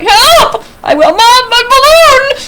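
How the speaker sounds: high, loud cry for help; acted child's desperate yell; panicked shout. A narrator's reading. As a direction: shout in alarm, pitch way up. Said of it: "I try to shout".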